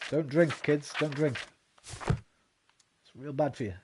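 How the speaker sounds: put-on loud then slow, preachy warning to kids; hammed-up public service announcement; mock PSA. Streamer warning young viewers about drinking, mock PSA. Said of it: "mock public service warning".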